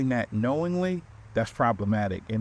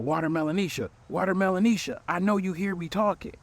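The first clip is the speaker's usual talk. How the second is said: calling out; 8 semitones higher than usual. Retold or imitated. Boyfriend calling out to her.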